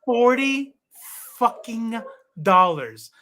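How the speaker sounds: loud, each word set apart; indignant emphasis. Real, not acted. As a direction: hit each word with outrage. Still angry at the brother's sale.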